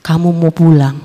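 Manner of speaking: low, calm divine voice; God calling her home in a low voice. Retold or imitated. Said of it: "Ada sebuah suara berkata".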